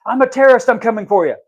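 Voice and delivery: loud, high-pitched pretend threat; mock threatening shout. Pretend terrorist threatening the people who fear them, mock threatening shout. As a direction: burst out as a make-believe menace.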